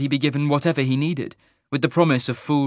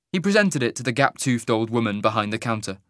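ordinary delivery, nothing marked

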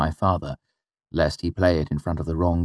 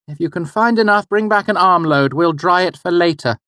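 mother's voice; 12 semitones higher, 5 dB louder than usual. Acted. Mother sending son away.

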